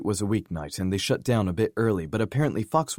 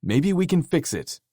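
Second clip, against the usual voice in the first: eager; 10 semitones higher, 4 dB louder than usual. Acted. Fox eager to help.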